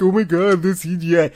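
high-pitched whiny mimicry of critics; mock complaining. Mimicking people who critique the show, mock complaining. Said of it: "people like to critique".

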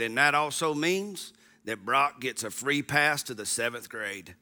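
put-on low, soft croaking kid voice fading away; a little son croaking his big news to dad; little froggy voice. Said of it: "in his little froggy voice".

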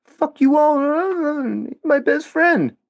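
high, mocking imitation of a friend defending his pal; high mock voice of Owen defending his friend; imitating Owen. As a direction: squeak it out like a whiny defender. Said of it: "Even Owen was like".

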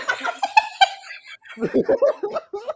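high laughing fit. Real, not acted. Laughing at own whale attempt.

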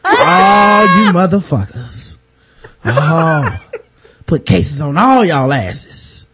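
low, menacing Training Day tirade; Denzel. Acted. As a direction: start the rant low, stretching it out. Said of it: "I would've turned into Denzel".

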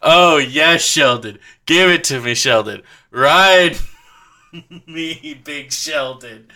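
hammed-up lover's cries, falling on each "Sheldon"; badly faked passion breaking into a laugh; mock passion.

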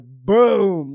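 loud burst of triumph; excited shout. Retold or imitated. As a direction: shout it like the catch just landed. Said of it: "and I was like".

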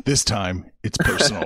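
deep dramatic trailer narrator; low, ominous tagline read; movie trailer voice. Acted. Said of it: "We need a movie trailer voice on here".

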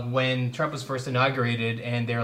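ordinary talk, nothing marked

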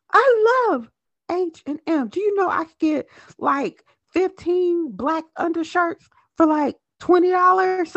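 high, loud gushing; gushing excitement. Real, not acted. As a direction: gush about it in a high voice. Gushing about sales.

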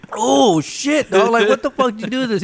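high, loud exclamation of disbelief; mock outraged yell. Friend filled his drink with vodka, so he yells in disbelief. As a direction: shout it at your buddy, half laughing. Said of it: "I was like".

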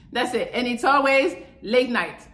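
normal talking voice

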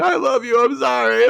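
high, loud wailing drunk impression; mock crying. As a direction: bawl it out like a weepy drunk, hammed-up. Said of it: "and she's crying, saying".

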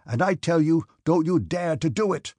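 high, loud warning; angry shout. A narrator's reading. Officer rushes to restrain the cadet, shouting angrily. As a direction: shout to stop him. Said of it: "shouted Denisov".

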